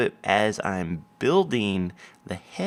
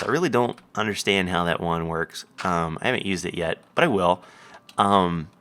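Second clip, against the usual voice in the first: ordinary talk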